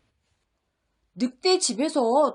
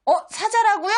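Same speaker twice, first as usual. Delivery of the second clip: surprised; 7 semitones higher, faster than usual. Acted. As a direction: sound caught off guard. Wolf surprised.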